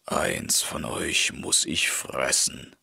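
low hushed threat; hungry wolf, low and hushed; menacing wolf. A narrator's reading. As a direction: growl it at the horse and foal.

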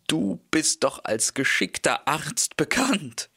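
pleading horse. A narrator's reading. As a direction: beg the wolf sweetly for help.

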